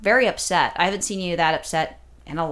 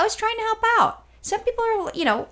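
high mock voice. Mock sing-song self-defence.

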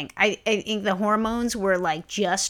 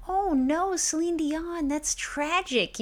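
high, slow mock lament; mock sad; 8 semitones higher, 3 dB quieter, much slower than usual. Telling her co-host how she mourned [name], mock sad. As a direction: pretend to mourn a star, drawn out. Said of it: "I actually got a little sad".

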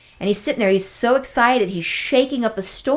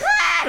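imitating a puppy's scream; mock puppy scream; 9 semitones higher than usual. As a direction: squeal like a hurt puppy. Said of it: "the puppy starts screaming".

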